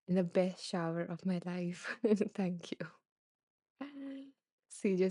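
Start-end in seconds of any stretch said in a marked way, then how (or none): none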